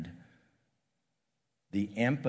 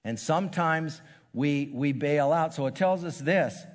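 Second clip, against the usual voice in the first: raised, higher preaching voice; preacher urging the congregation about faith, raised and higher; 4 semitones higher, 3 dB louder than usual. Acted. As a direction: lift it up and exhort the congregation. Preacher lifts into exhortation.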